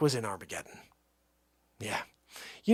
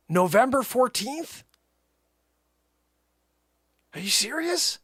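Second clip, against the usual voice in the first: high incredulous complaint; can't believe the wait; high, stunned protest; outraged disbelief; 8 semitones higher than usual. Real, not acted.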